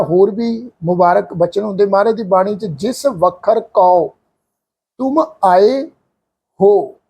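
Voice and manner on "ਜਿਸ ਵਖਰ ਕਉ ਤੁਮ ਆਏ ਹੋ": very slow, word by word, ਹੋ short at the end; slow scripture recitation. Shown as a demonstration. Teacher reciting a scripture example for the sangat. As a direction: recite the line slowly and reverently. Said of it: "ਇੱਥੇ ਪਾਠ ਆਏਗਾ ਹੋ".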